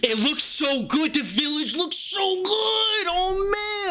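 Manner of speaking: high, slow, awed near-cry; awed gushing. Real, not acted. Host marvelling at new village footage, awed and high.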